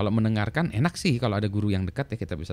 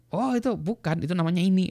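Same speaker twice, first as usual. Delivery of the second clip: correcting colleague; 10 semitones higher than usual. Retold or imitated. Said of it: "colleague corrects Feynman".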